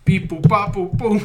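mock alien beeps. Alien replying in beeps, laughing. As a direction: beep like the alien.

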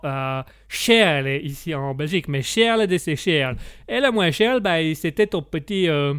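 mock Italian accent with added vowel endings; sing-song Italian accent, hunting for words. Italian guest grumbles about his cheap lodging, sing-song Italian accent. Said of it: "comme on l'entend à mon accent".

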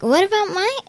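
high rising whine; mock whiny question. Retelling Riley asking the teacher, high whiny question. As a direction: pitch it up like a hurt kid. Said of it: "she's like".

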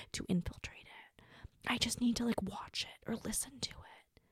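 hushed, scheming whisper; conspiratorial whisper. Pretend. Podcaster confiding a plan to snoop on drama, conspiratorial whisper. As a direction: whisper it like a guilty secret.

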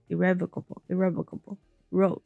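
plain reading voice

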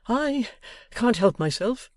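high, forlorn cry. A narrator's reading. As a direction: cry out, beaten. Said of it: "cried Mister Boffin".

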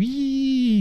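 high-pitched drawn-out wacky "yee"; nutty noise. Shown as a demonstration. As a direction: let out a playful, squealing wheee. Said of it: "making nutty noises".

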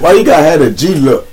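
loud high teasing question; playful mocking jab. Real, not acted. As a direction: rib him about the photo. Teasing friend about photo look.

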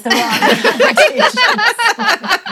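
words broken up by a real burst of laughter; laughing through it. Real, not acted. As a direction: laugh your way through the end of the sentence. Laughing while explaining a name.

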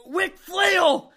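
shrill, loud, laughing cry; Batista voice. Retold or imitated. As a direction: scream the name mockingly. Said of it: "And he's like".

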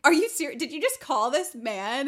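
loud, high-pitched mock scolding; outraged disbelief. Co-host scolding the tattletale for calling, outraged disbelief. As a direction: shout the name, then demand answers incredulously.

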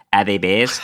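dopey Australian tourist impression; imitated Australian accent. Australian seatmate on a flight to Alaska, imitated Australian accent. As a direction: ask it in a put-on Australian accent. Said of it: "when I think of an Australian accent".

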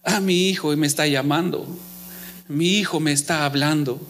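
low, hushed-pitch fatherly aside; low-pitched voice of a listening father; God as father. Acted. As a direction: say it low, as a father recognising his child's call. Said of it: "Y dice".

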